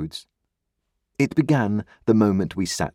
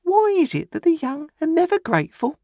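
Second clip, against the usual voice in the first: very high, piping mournful lady; 4 dB louder than usual. A narrator's reading. Mrs Hubble lamenting the young, in a high piping voice. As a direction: sigh over the ungrateful young. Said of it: "mournful presentiment".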